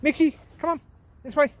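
high, loud call across the field; shouting for the dog outdoors. Real, not acted. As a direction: call him back to you. Calling dog on a walk.

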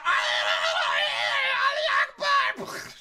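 high, wild penguin shriek; frantic high gibberish cry; imitated attack screech. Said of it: "That's what he did".

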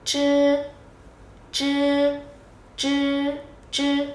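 "chư" tròn miệng, lưỡi cong; "chư" không bật hơi, lưỡi cuộn; tiếng Trung zh. Shown as a demonstration. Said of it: "Đầu tiên nhá, Z H này". ("chư" cong lưỡi không bật hơi)

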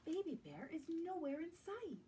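plain reading voice

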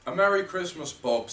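high hearty call on Merry; sincere and bright, meant fully; earnest greeting. A narrator's reading. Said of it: "with an earnestness that could not be mistaken".

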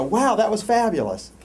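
high, loud gush of admiration; gushing audience member. Acted. Spectator reacting to a dance, loud gushing. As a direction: play an impressed spectator. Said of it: "And you go".

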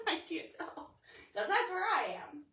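giggling while teasing; light laugh through words. Real, not acted. Teasing viewer about creeping.

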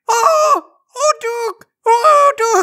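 very high alien screams; horrified aliens. Acted. Aliens after the suited man is shot, high screaming. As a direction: squeal gibberish in tiny-voiced horror.

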